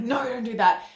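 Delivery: mock parental shout at a toddler. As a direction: snap it like an exasperated parent. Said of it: "instead of just shouting like".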